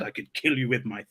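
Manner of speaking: low, hushed menacing quote; quiet threat. Retold or imitated. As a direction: deliver the threat softly and low. Quoting a film character's threat.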